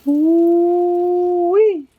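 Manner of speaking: high, loud delighted holler; excited whoop. Real, not acted. Admiring the smoked turkey.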